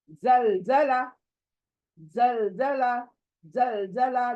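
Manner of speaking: Jaljala said slowly with a forceful ज; forceful zalzala. Shown as a demonstration. Teacher showing the forceful letter in Jaljala. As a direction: stress the hard ज in Jaljala, slow. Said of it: "खुद जलजला भी सख्त है".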